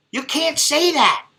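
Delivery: high, slow scolding mother voice; lightly voiced worried mom; mother's warning. Mother warning her son in the store, high and slow.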